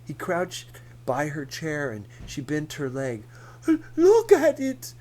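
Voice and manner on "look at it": high, loud theatrical moan. Acted. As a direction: whine for attention. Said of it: "she moaned".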